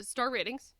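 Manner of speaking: ordinary talk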